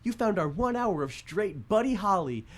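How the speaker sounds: put-on high old-time radio announcer. Shown as a demonstration. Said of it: "good old-fashioned old radio voice".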